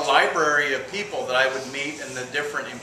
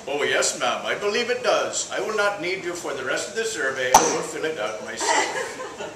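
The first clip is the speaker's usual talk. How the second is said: polite Indian survey caller; Indian accent. Acted. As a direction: answer in an Indian accent, near your usual pitch. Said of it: "The Indian calls the old woman".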